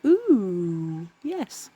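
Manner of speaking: pleased ooh yes. Retold or imitated. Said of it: "look at it and think".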